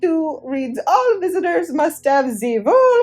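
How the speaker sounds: put-on high, loud French-accented officialese; mock French accent. A narrator's reading. Judge quoting rules to Rolf, mock French accent. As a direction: recite the rulebook in bad French accent. Island judge reciting absurd rule.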